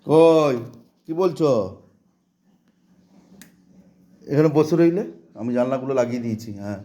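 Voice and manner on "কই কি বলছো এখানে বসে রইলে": high, loud call across the room. Real, not acted. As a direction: call out to the little one. Calling out to the child.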